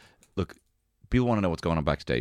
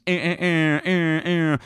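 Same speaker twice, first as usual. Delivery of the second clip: mock fanfare chant; 9 semitones higher, 5 dB louder, slower than usual. Jingle noise after Top Trumps.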